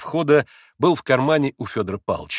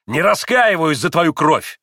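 loud, raised, measured outburst; slow vehement cry; hateful exclamation; 5 semitones higher, 5 dB louder, slower than usual. A narrator's reading. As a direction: declare it defiantly to the wounded father. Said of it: "воскликнул он".

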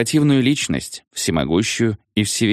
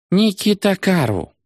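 high, slow exclamation in a foreign tongue; exclaiming Shah; 11 semitones higher, slower than usual. A narrator's reading. The Shah admiring the soldier, a high, slow exclamation. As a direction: exclaim it with delight, a lightly voiced foreign ruler. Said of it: "воскликнул Шах".

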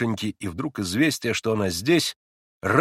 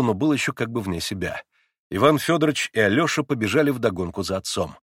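ordinary delivery

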